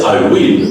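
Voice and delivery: "will" low, with a long ee like "weel"; tense the vowel of "will"; wrong will. Shown as a demonstration. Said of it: "Don't say".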